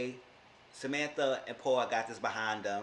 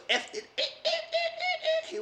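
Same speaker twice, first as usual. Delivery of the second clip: laughing squeak; 4 dB louder than usual. Real, not acted. Laughing while retelling his swearing.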